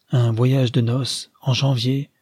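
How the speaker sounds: ordinary delivery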